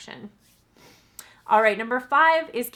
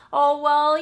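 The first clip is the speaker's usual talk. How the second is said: mock lazy excuse; 6 semitones higher, 9 dB louder than usual. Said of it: "being sort of lackadaisical".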